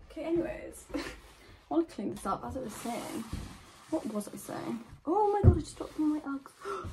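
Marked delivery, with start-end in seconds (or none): 5.1-6.9 high, loud oh my god; startled exclaim. Real, not acted. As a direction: blurt it in alarm. Drops her boot.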